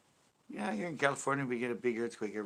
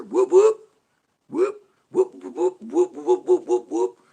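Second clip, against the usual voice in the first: very high loud repeated whoops; siren whoop; 16 dB louder, slower than usual. Retold or imitated. Cop leaning on the siren, loud whoops. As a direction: keep whooping, the driver won't stop. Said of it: "They not pulled over?".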